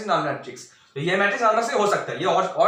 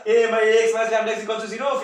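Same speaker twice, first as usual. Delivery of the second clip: louder, careless, offhand recital; careless teacher; 5 semitones higher, 6 dB louder than usual. Retold or imitated. Mocking a careless teacher's sloppy proof, louder and offhand. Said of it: "मैं ऐसे ही करता".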